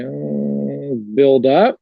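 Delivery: long drawn-out "oh", then a rising answer; hesitant, drawn-out mock answer; eye-rolling guess. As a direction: mimic a client guessing, pitch lifting at the end. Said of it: "kind of roll their eyes".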